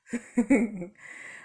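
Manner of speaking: real laugh. Real, not acted. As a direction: laugh at her own hype. Laughs after praising dad's fish.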